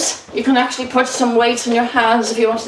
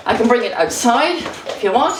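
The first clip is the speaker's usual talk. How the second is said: ordinary talk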